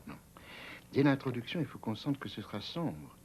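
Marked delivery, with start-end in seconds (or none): 0.1-0.1 whispering. Acted. Corrects pupil softly.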